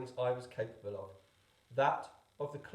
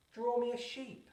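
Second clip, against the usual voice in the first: put-on a small child pleading, pitched much higher; strange little voice; 10 semitones higher than usual. A narrator's reading. Little prince waking the pilot, high small child's voice. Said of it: "a strange little voice".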